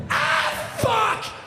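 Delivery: high loud yelp of shock; mock yell. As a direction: yell as you hit the ground. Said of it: "was my first words".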